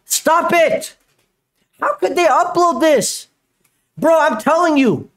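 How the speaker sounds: high-pitched, outraged yell; exasperated yelling. Real, not acted. Streamer protesting a wrong fact in the clip, yelling. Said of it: "I can't yell anymore".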